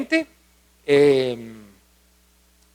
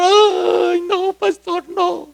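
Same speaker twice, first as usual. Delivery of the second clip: high, mock tearful girl's voice; imitated crying girl; 11 semitones higher, 3 dB louder than usual. Pregnant girl confesses to the pastor, mock crying. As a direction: play the sobbing pregnant girl, pitched up. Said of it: "comienza a llorar".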